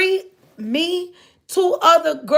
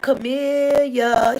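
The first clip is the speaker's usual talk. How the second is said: slow, drawn-out eerie calling of a name; spooky eerie voice; much slower than usual. Retold or imitated. Pastor's son calling her name in a slow spooky voice. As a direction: drag the name out like a creepy ghost. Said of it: "he said my name in this real weird, spooky, evil voice".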